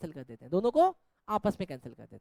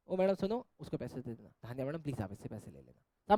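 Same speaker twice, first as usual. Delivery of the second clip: soft coaxing plea; 10 semitones lower, 8 dB quieter than usual. Acted. Acted coaxing plea to madam.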